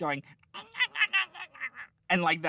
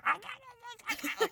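put-on very high, childish ah ah ah; child-like lizard cries; 3 dB quieter than usual. Imitating the talking lizard for her friend with childish squeals. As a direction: squeal it like a little kid. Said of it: "sounded like a child going".

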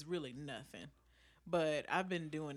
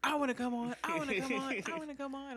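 eager pleading; 9 semitones higher, faster than usual. Retold or imitated. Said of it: "everybody was like".

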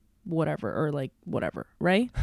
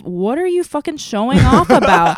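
louder, imitating an outraged critic; mock indignant accusation; 4 semitones higher, 6 dB louder, faster than usual. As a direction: scold a show-off in mock outrage. Mimicking people accusing her of showing off.

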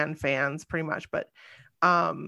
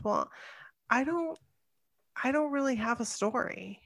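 soft, higher-pitched mock hesitancy; imitated reluctant fans; 5 semitones higher, 9 dB quieter than usual. Host imitates fans who claim no story, hesitant. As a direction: say it small and hesitant, imitating someone.

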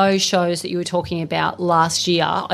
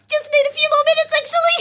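much higher, tight little voice; imitating herself close to tears, pitched way up; strained squeak; 4 dB louder, faster than usual. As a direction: squeak out a plea for more time. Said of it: "I'm like".

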